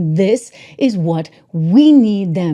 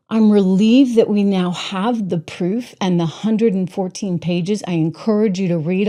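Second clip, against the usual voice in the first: ordinary delivery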